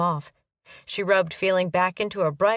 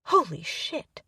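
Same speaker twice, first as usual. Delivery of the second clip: a sharp, higher cry of surprise; startled yelp; 4 semitones higher, slower than usual. Acted. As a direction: yelp it as the truck jolts. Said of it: "she yelped".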